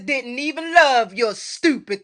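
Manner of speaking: hammed-up sitcom sister scolding; mock scolding Fred, slow and pointed; sitcom insult. Quoting sitcom character at Fred.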